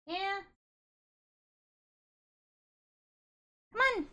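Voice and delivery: high, sing-song voice for the cat. Real, not acted. Baby talk to her cat.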